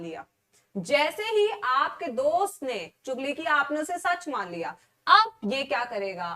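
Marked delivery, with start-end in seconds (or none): none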